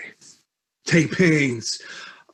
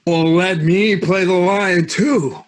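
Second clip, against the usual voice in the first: eager; 7 semitones higher, 5 dB louder than usual. Acted. Bottom eager for more parts.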